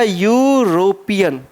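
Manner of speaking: European drawn out slowly, starting with a clear y sound; teacher modelling European slowly for students; slow careful pronunciation. Shown as a demonstration. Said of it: "क्या हो जाएगा".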